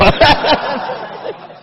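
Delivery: high silent giggle; high breathless laugh. Real, not acted. Preacher laughing at the punchline, a high breathless laugh.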